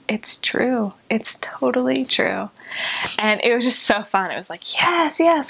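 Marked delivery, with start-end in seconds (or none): 0.1-2.5 whispery, slow mock-tearful wonder; acted tearful awe, drawn out and breathy; imitated bawling. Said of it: "he just starts bawling". 4.7-5.5 high, thrilled cheer; excited, high-pitched agreement; retold delight. Retold delighted reply to his news.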